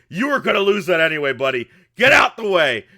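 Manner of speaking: loud, high, dismissive yell; brusque shout. Acted. Grant to a worker who lost an arm, brusque shouting. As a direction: holler an impatient order over the fight.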